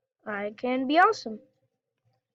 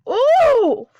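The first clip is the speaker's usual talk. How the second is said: high loud oof; 11 semitones higher, 10 dB louder than usual. Real, not acted. Ship hit hard.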